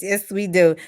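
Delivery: usual speaking voice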